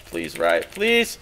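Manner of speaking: whiny rising plea; desperate plea. Real, not acted. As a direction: beg the game to help. Begging game for luck.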